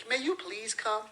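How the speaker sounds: daintily courteous, lightly hammed-up; overly polite request. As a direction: imitate a mother asking ever so nicely. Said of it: "She doesn't say".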